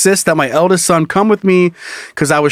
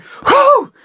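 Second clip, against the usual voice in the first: relieved whew. Retold or imitated. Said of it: "you're like".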